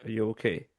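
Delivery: ordinary talk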